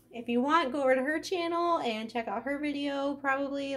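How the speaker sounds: usual speaking voice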